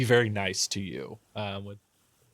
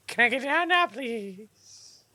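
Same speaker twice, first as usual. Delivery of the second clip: very high, small squeaky plea; pleading little half-orc; 14 semitones higher, slower than usual. Acted. Half-orc girl asking to be put down, high squeaky plea. Held character asks to be released.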